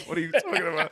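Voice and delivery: incredulous laughing repeat; laughing disbelief. Retold or imitated. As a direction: laugh through the confused question. Repeats his confused reply laughing.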